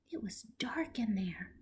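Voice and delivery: whispered narration; hushed, spooky whisper. A narrator's reading. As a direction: whisper it like a secret in the dark. Reading the dark deep.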